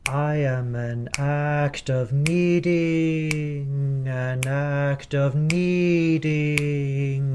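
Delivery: slow, spaced rhythmic speaking; spoken under tempo. Shown as a demonstration. Choir director speaking the lyric slowly under tempo. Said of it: "sound spoken".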